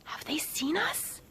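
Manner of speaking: slow, high, breath-only whisper; anxious whisper. Acted. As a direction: whisper it as if hiding from discovery. Hiding, afraid of being caught.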